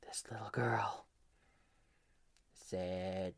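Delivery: hushed storyteller whisper. A narrator's reading. Telling a bedtime tale, secret whisper. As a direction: lean in and whisper it.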